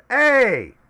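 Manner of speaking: sudden loud high yell from the background; shouted hey. Acted. A bystander yells out at the standoff, loud and high. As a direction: bark a startled hey across the room. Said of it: "a Mexican goes".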